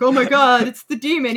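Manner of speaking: loud, hammed-up gasp of horror; mock terrified cry. People in the game seeing Doom Guy, mock terrified shout. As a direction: yell it like a panicked bystander seeing a demon. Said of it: "they're like".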